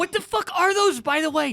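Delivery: incredulous. Real, not acted. Incredulous question.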